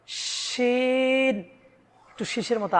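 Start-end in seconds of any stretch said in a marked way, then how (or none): 0.1-1.3 শিন held long and heavy at a higher pitch; heavy shin. Shown as a demonstration. Teacher contrasting thin and heavy letters for viewers. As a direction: draw শিন out with a thick sound. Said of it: "মোটা করে শিন উচ্চারণ করতে হবে।".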